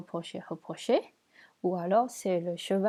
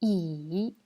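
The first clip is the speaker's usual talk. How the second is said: "I" dipping low, then rising; third tone; 3 semitones lower than usual. Shown as a demonstration. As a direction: draw out "I" with a dip and a lift at the end. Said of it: "C'est le troisième ton".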